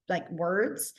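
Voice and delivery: normal talking voice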